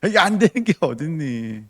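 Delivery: laughing through a mock scold; laughing rebuke. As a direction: tease them while laughing. (웃으면서 나무라듯)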